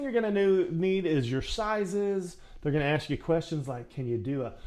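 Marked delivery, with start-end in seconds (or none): none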